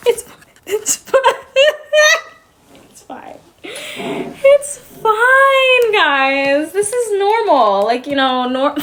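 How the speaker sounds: high, half-laughing, half-crying voice. Real, not acted. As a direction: insist it's fine through laughing tears. Laugh-crying about mother.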